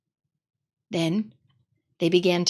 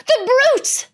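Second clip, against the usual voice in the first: even higher, louder tearful cry; crying girl, building; 5 dB louder than usual. Acted. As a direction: let the sobbing cry break higher. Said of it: "tears streaming down her cheeks".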